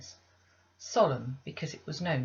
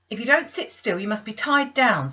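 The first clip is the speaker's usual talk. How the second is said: loud, high warning; stern threat; 6 semitones higher, 9 dB louder than usual. Acted. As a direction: threaten to tie her down. Bessie threatens the girl.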